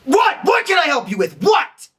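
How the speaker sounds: snapping, frustrated. Shown as a demonstration. Overwhelmed clerk snapping at a customer who asks for help. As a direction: bite their head off. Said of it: "super frustrated".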